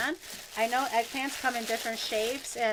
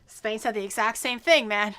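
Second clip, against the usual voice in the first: ordinary delivery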